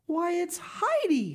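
very high cry of amazement; astonished exclamation. A narrator's reading. Peter's mother walks in and sees Heidi, astonished exclamation. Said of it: "she exclaimed".

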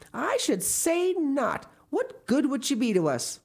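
high, emphatic dismissal; indignant. A narrator's reading. Marilla rejects keeping the girl, indignant.

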